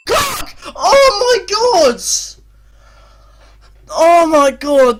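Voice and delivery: very high, drawn-out cry; anguished scream. Real, not acted. Manager reacts to the attack's outcome, screaming long and high. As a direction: scream in disbelief, stretching the words. Chance missed late in final.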